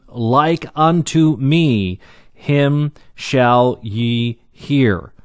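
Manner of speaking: very slow, word by word; slow, weighty emphasis. Acted. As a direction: spell out the punchline slowly, a pause after each word. Climax of scripture, the kicker.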